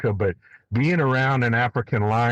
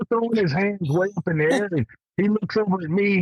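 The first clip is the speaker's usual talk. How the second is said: ordinary talk, nothing marked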